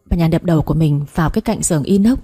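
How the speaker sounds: ordinary delivery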